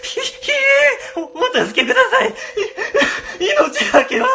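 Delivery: high, loud quavering plea for mercy; terrified begging. Acted. Boss begging the ghost to spare him, terrified. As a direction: wail for your life, half laughing.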